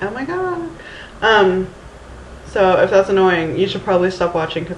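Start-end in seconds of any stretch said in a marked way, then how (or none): 0.0-0.7 high, soft "oh my God", rising; lightly mocking your own Valley Girl sound; small high-pitched mock dismay; Valley Girl reaction. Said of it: "I sound like a Valley Girl".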